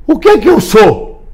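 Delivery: high, slow cry for an answer; mock desperate plea. Man asking viewers who he is, mock desperate.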